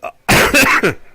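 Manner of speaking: mock choking cough, high and forced; theatrical fake cough. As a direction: cough as if the word chokes you. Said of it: "I think I'm choking on it".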